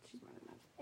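ordinary talk

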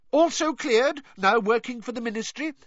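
high, huffy dismissal; offended official, pitched high; dismissive. A narrator's reading. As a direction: brush it off indignantly. Fudge arguing back, affronted.

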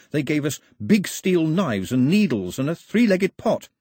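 a chatterbox who can't stop telling it; eager chatty Pilu. Acted. Pilu chatters about his voyage.

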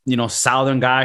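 usual speaking voice

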